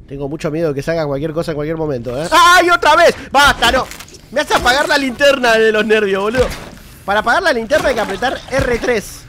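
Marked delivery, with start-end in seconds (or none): 2.3-9.2 much higher, louder frantic cries; panicked yelling. Real, not acted. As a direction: panic as it jumps you again. Monster attacks again. (gritos de pánico, agudos y fuertes)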